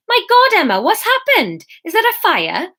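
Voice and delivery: high, loud startled outburst; shocked to see her on the floor; a friend rushing in, alarmed. Acted. Fiona alarmed at the crash.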